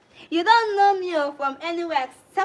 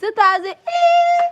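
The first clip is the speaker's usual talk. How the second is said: excited cry; 8 dB louder, slower than usual. Real, not acted. Reacting to a big super thanks.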